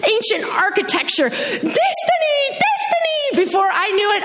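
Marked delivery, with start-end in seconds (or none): 1.3-3.3 high, slow, joyous shouting; yelled. Retold or imitated. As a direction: whoop it out from a speeding scooter. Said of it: "he yelled as we drove".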